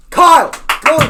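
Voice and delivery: high, booming yell at a student; yelling principal. Retold or imitated. Principal yelling at a student in the lunchroom, furious shout. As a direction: shout the name and order like an angry principal. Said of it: "he yells".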